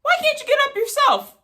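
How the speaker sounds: high, exasperated snap-back; exasperated retort. Retold or imitated. Son mocking mom's request, high and exasperated. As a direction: sound fed up with her.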